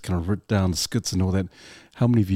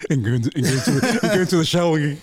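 high, giggly delivery; laughing while talking; 9 semitones higher, faster than usual. Real, not acted. Interviewer joking with guest about the shower, real laughter. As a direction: crack up through the words.

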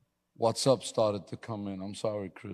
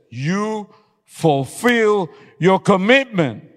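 firm wife; 9 semitones higher, 11 dB louder, much slower than usual. Retold or imitated. Wife's firm answer in story.

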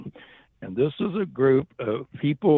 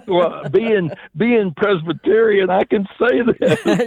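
laughing; 8 semitones higher, 7 dB louder, slower than usual. Real, not acted. Guest laughing as he justifies teasing Presbyterians. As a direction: still laughing, higher and louder, slowing down.

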